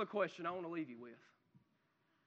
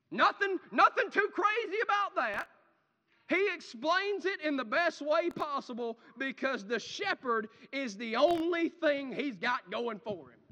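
louder and higher preaching, pressing on; fiery preaching; 4 semitones higher, 5 dB louder than usual. Acted. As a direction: drive the point home from the pulpit. Preacher rising in intensity.

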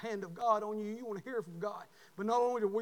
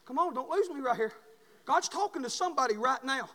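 usual speaking voice

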